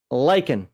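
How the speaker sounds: lichen said LIKE-en; lichen as LIE-kən; dictionary pronunciation. Shown as a demonstration. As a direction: say lichen with a hard k, like "liken". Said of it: "Merriam-Webster".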